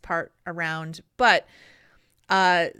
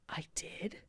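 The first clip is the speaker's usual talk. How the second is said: stunned whisper; 2 semitones lower, 20 dB quieter than usual. Retold or imitated. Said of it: "And I was like".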